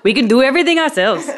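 bad, laughing Indian accent; hammy Indian accent, high and laughing. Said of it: "That was my horrible attempt at an Indian accent".

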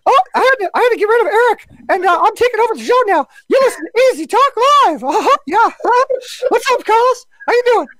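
very high, squeaky falsetto cartoon voice; Mickey. Host jokes he's taking over the show, high cartoon-mouse voice. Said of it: "I'm gonna do Mickey".

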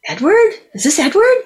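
high, rising disbelieving question; retold puzzled query. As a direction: act out puzzled recognition, pitched right up. Retells puzzled answer on phone.

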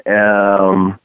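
ordinary talk, nothing marked

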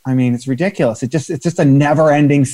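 ordinary delivery

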